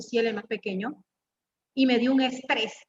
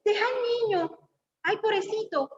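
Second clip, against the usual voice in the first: much higher, pampering mother voice; coddling mother; 9 semitones higher than usual. Retold or imitated. Preacher voices a mother shielding her son, coddling and high. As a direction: coo over the poor child. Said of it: "ella dice".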